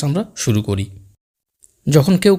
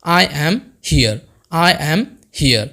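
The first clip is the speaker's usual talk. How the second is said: slow teaching demo; much slower than usual. Shown as a demonstration. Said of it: "তখন আমি বলবো".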